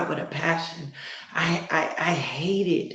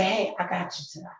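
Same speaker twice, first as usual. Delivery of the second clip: God's comfort; 7 dB quieter, faster than usual. Acted. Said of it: "he wanna be the one to just say".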